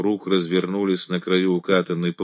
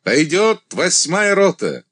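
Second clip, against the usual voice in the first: commanding; 10 semitones higher, 4 dB louder than usual. A narrator's reading. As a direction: announce it to the ranks. Commander addressing regiment.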